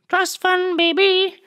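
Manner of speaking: put-on trust fund baby sung up very high; high sing-song taunt at the rich girl; high mocking singsong. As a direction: squeal it like a taunting kid.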